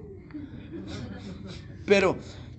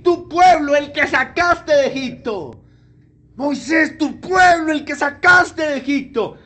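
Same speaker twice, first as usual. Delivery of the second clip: raised, louder booming rebuke from God; angry God; 5 semitones higher, 6 dB louder, slower than usual. Acted. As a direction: scold Moses like an angry God, loud and high. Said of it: "Dios que le había acabado de decir". (Dios enojado, voz alta y fuerte reprochando)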